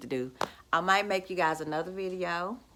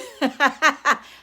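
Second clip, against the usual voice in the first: gloating laugh; 6 semitones higher, 9 dB louder than usual. Real, not acted.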